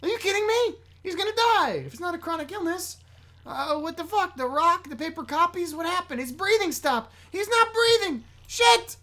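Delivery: very high, loud frantic outburst; panicked shouting. Acted. Podcaster acting out friends watching Usagi die, panicked shouting. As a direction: build from alarmed disbelief to an all-out shout.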